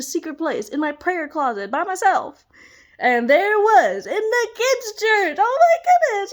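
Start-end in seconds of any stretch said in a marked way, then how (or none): 3.0-6.3 much higher and louder, laughing with joy; overjoyed exclamation. Real, not acted. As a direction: burst out in joyful disbelief. Overwhelmed telling kids' church miracle.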